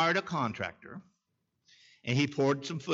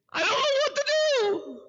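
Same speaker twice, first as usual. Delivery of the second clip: high, tearful falsetto imitation; imitating crying woman; 4 dB louder than usual. Mayor imitating the crying young woman at his desk, high tearful voice. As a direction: squeak it out like a weeping young woman. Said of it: "That's the way she sounded".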